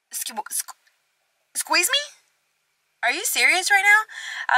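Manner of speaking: high, stammering shock that breaks into a squeal of disbelief. Retold or imitated. Waking up next to her friend and the guy, stunned and high-pitched. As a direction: stammer then squeal it, like you can't believe your eyes.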